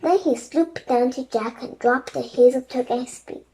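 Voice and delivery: ordinary delivery, nothing marked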